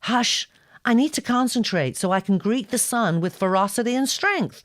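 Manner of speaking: high, loud, intent shushing; bossy hush. Acted. Girl hushing the boy before greeting the sun, high and loud. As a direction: shush him and steel yourself to look fierce.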